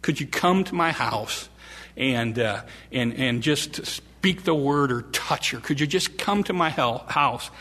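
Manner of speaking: soft, low, desperate plea; a father's soft desperate plea; hushed pleading father. Acted. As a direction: beg quietly for his dying girl.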